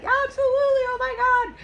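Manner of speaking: very high, loud squealed yes; imitating an excited shriek; ecstatic acceptance. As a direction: squeal it as if overjoyed at a proposal. Fiancée's excited yes, acted out.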